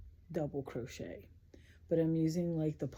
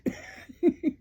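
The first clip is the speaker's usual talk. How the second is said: burst of laughter; 13 semitones higher, 6 dB louder than usual. Real, not acted. Laughs at the SNL Kathy skit.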